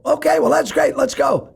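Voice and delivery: very high, impatient; eager to leave. Retold or imitated. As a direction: wrap it up briskly.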